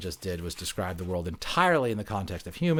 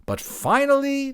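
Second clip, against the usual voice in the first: proud build-up; 7 dB louder than usual. Acted. Climax of story.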